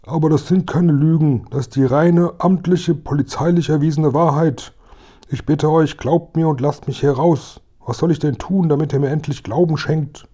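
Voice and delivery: high, pleading captive voice; begging policeman. Acted. Locked-in officer begs the boys to believe him, pleading. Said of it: "aufs Betteln verlegt hatte".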